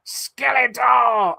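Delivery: slow, high, drawn-out villain cry; Skeletor shout. Retold or imitated. The Skeletor figure announcing itself, drawn-out shout. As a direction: bellow the name like a cartoon villain. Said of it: "he's like".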